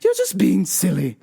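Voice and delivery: lilting mom impression; soothing mom. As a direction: placate dad in a put-on lilt. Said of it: "My mom's like".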